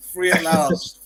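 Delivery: laughing through the words, slow; slow, laughing free at last. Real, not acted. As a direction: gasp it out mid-laugh. Laughing reading of meme caption.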